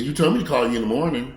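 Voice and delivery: building to a loud high yell; angry brother, rising to a yell; retold angry brother. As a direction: brother snapping at being called too early, rising to a shout. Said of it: "As he said".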